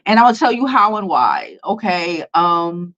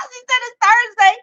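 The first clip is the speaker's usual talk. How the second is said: higher, laughing through the words. Real, not acted. Laughing about the viewer's correction, higher and giggling. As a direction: laugh as you say it.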